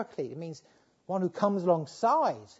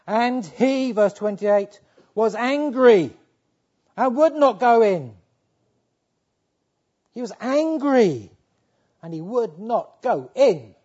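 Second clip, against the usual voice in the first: loud, ringing pulpit voice; booming sermon delivery; preaching at full force; 5 semitones higher, 11 dB louder, slower than usual. Acted. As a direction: preach it out to the whole hall. Preaches the elder son's anger.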